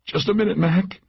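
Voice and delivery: high character voice of a hurt companion; gritted teeth. A narrator's reading. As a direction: put off a friend while in pain. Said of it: "her teeth gritted".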